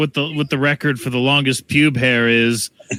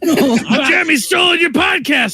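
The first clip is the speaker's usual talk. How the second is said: shouting. Pretend. Mock takeover announcement.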